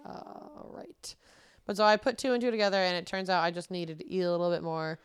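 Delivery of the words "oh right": breathy hushed "oh, right"; whispered realization. Retold or imitated. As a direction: drop to a near whisper. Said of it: "And I was like".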